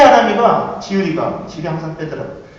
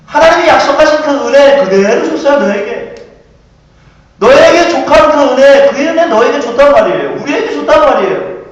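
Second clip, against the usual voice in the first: raised, emphatic sermon voice; forceful preaching; 4 semitones higher, 6 dB louder than usual. Acted. Preacher insists to the congregation that grace was given, forceful preaching. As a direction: drive the point home to the congregation.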